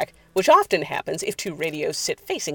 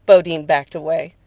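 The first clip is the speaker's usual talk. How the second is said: usual speaking voice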